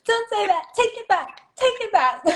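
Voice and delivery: high, urgent playful protest; mock alarm. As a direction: order it taken back in squeaky-high pretend panic. Parent mock-panicking at child growing up.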